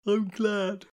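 high, stretched words through a yawn. A narrator's reading. Astrid sleepily approves of Kitty resting, yawning. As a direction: say it while yawning. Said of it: "she yawned".

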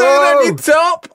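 high, slow loud warning; alarmed shout. Real, not acted. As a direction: shout it urgently. Blood dripping on top.

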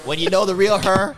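loud, high mock brag; teasing. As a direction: ham it up like a smug cohost.

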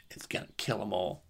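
hushed, ominous whisper of doom; dramatic whisper. Acted. Telling listeners the shark will kill everyone, in a whisper. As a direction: breathe it like a horror trailer. Shark about to hit the beach.

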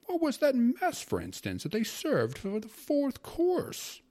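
loud, high-pitched scolding rant; indignant grumbling uncle. A narrator's reading. Uncle berates his sister over the governor's dinner, indignant grumbling. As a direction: scold in a high, exasperated voice, stretching key words. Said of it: "my uncle grumbled, wrinkling up his face".

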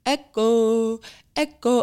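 high, drawn-out street vendor call; sing-song mock vendor cry; newspaper seller's cry. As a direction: imitate a paper seller's repeated chant. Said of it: "there's a guy who sells newspapers and says".

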